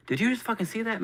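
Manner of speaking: high-pitched disbelief; astonished. Retold or imitated. Asking a friend about the ninja kick, high and astonished. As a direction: blurt it high, like a stunned witness.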